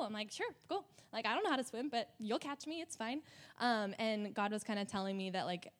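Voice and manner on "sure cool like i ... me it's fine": high, carefree imitation of a trusting toddler; little two-year-old self. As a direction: play yourself as a small, game kid. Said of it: "this little two-year-old [name]".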